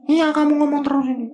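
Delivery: high puppet-like sidekick butting in; Bobi voice. Acted. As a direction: pitch way up into a cartoon sidekick voice. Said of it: "Bobi tanya deh".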